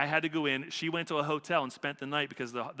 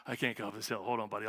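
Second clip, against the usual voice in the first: imitating a winded hiker, low and soft; gasp, then act too winded to climb; out of breath; 5 semitones lower, 5 dB quieter, faster than usual. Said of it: "I get really out of breath".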